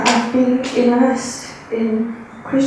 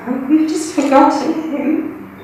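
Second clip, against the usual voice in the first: normal talking voice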